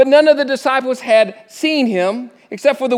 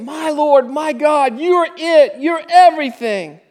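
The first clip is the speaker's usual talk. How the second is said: high, ringing exclamation of faith; exclaiming; 7 semitones higher, 3 dB louder than usual. Acted. Thomas finally believes, exclaiming to Jesus. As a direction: cry it out in wonder. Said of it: "Thomas exclaimed".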